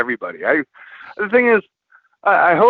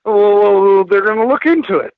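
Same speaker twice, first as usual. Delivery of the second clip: hedging partner; 6 semitones higher, 5 dB louder than usual. Retold or imitated. Retold partner's nervous hedge.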